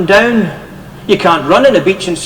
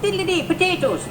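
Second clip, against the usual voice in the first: high, mock Irish lilt; Irish accent; 11 semitones higher, 10 dB quieter than usual. Shown as a demonstration. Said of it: "This would be an Irish person".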